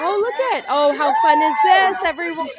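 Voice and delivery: high, gushing sing-song welcome; mock teacher voice. Mocking a teacher welcoming kids, high and gushing. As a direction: gush like a fake-cheerful teacher. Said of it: "This is your teacher welcoming you in".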